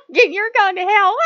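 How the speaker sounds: high, loud shout through laughter; laughing, high-pitched outburst; laughing mock doom. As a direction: crack up while crying out loudly. Jokes she is going to hell.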